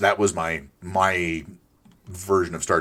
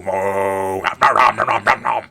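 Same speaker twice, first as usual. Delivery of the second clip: loud, rapid nom-nom gobbling; munching noises; 6 dB louder, slower than usual. Pretend. Player as cannibal cow eating, cartoon munching.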